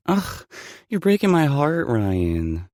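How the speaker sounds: mock heartbreak. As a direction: play it as fake heartbreak. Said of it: "she replies with a sigh".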